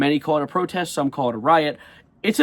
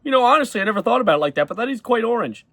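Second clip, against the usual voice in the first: mock gullible viewer; 7 semitones higher than usual. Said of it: "some dude watching the television".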